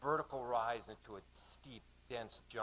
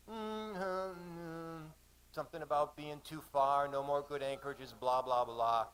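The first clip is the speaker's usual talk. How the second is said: higher, droning imitation of a vague excuse; dismissive mimicry; 4 semitones higher, 3 dB louder than usual. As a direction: hum and hedge, then rattle off blahs. Vague answer mimicked as mumbled blah.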